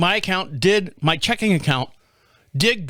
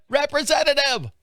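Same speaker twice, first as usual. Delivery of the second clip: higher, exasperated second try; pleading repeat; 9 semitones higher than usual. Retold or imitated. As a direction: beg the machine this time.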